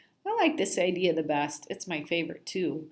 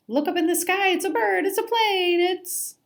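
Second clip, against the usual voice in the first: high, loud cry of look up there; shouting at the sky in mock wonder; excited pointing; 12 semitones higher, 5 dB louder than usual. Fish distracting fishermen, pointing.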